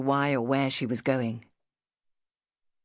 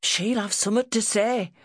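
higher-pitched northern lad; worried boy; 6 semitones higher, 4 dB louder than usual. Acted. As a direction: fret aloud in country dialect.

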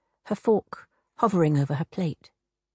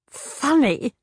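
laughing; 7 semitones higher than usual. A narrator's reading. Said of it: "the hag laughed".

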